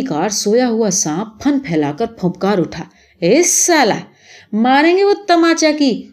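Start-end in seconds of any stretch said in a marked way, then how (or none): 3.2-6.0 high, indignant outburst; sudden acted anger, pitched high; angry threat. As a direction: flare up and threaten the boy. Insulted man threatens to slap.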